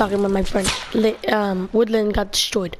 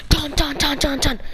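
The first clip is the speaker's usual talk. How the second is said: imitated knocking; 4 semitones higher than usual. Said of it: "It's like".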